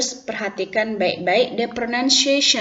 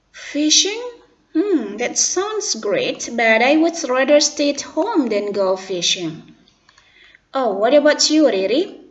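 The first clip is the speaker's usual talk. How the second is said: dialogue roles; 4 semitones higher, 6 dB louder, slower than usual. Acted. Santi then Riri in role.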